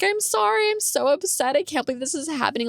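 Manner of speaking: high-pitched mock tearful wail; retold crying. Crying apology to her friend, high imitated whine. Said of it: "like, crying".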